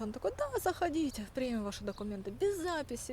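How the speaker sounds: ordinary delivery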